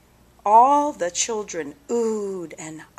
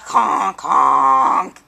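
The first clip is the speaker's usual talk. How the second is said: low nasal-free honk, loud on the second; a schoolyard bully's mock duck honk; 8 semitones lower, 4 dB louder than usual. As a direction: honk like a sick duck to tease. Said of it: "You sound like a sick duck".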